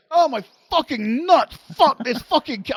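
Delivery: high, agonized cursing retold; swearing in pain. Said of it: "I'm swearing so much".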